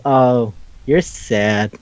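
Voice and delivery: low, slow pity; mock pitying. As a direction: imitate someone gently pitying a hopeless case. Ki-chan pitying her cousin.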